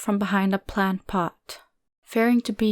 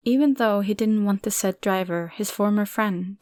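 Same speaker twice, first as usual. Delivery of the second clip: usual speaking voice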